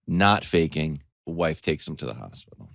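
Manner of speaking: ordinary talk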